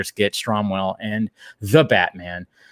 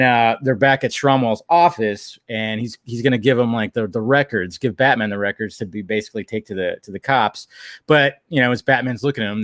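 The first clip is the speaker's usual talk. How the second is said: ordinary delivery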